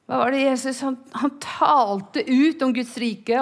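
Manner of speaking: normal talking voice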